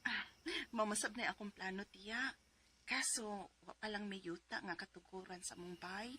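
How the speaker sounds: ordinary talk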